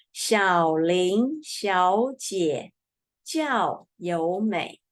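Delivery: slow, careful model sentence for repetition; slow model reading. Shown as a demonstration. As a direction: read it slowly for students to repeat. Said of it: "repeat after me".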